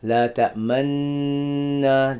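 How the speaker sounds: La ta'manna drawn out slowly with lips curled on the nun; rawm recitation. Shown as a demonstration. Teacher demonstrates rawm by curling the lips on La ta'manna. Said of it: "You gotta curl your lips".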